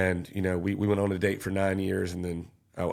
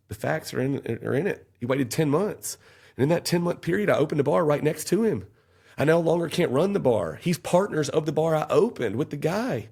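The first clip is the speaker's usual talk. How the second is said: heated plea; 7 semitones higher than usual. Retold or imitated. Defendant pleading to the jury, rising and insistent. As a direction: pitch climbing higher, pressing hard. Arguing his case to grand jury.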